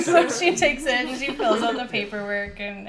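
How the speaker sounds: usual speaking voice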